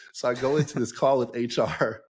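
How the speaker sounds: ordinary talk, nothing marked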